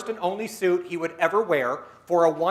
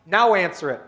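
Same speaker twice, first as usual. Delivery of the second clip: usual speaking voice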